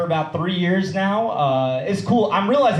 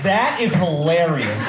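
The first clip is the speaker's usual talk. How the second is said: slow, stiffly deliberate imitation; enunciated; 3 dB louder, slower than usual. Mocking the man who reacted to his joke, over-enunciated. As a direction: separate every word with exaggerated care. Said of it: "he enunciated".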